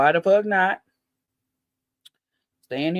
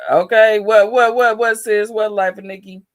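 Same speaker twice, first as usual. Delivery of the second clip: excited greeting; 4 semitones higher, 7 dB louder than usual. Real, not acted. Greeting a viewer in chat.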